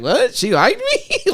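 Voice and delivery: high-pitched mock astonishment; retold disbelief. Man recalling a girl's secret crush, retold disbelief. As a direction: shoot the pitch up in acted surprise. Acts out his own surprise.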